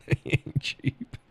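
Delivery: slow, high, laughing repeat. Real, not acted. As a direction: squeeze the words out through laughter. Laughing about the price.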